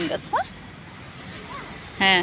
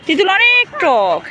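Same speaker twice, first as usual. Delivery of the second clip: put-on slow, drawn-out warning to a child; exaggerated sour warning; 5 semitones higher, 4 dB louder, much slower than usual. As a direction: stretch it out to warn them. Warning toddler fruit is sour.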